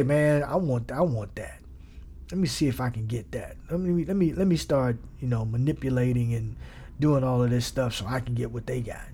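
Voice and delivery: low, scheming, grasping voice; a hint of a scheming covetous voice. Shown as a demonstration. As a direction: sound greedy and calculating under your breath. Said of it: "you can even hear it in the tone of voice".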